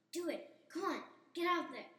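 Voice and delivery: imitating an excited dad coach; pushy sideline urging. Kid imitating dad urging her on, pushy and excited. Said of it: "you're just, like, really like".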